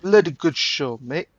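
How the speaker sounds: clumsy mock British accent, cheery; a bad British accent, all good cheer; amateur British accent, hammed-up; butchered British accent. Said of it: "I probably butchered that accent".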